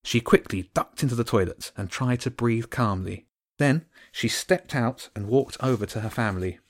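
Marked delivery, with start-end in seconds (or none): none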